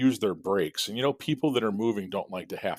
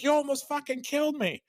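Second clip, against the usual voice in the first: imitating screaming old lady. Old lady screaming at the reckless driver, mock shriek. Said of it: "And the old lady's like".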